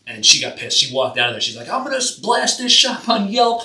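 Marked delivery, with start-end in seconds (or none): 1.7-3.6 higher, louder mock threat from the client; angry client. Tattoo artist mimics an angry client threatening Yelp, higher and louder. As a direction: play the huffy client threatening a bad review.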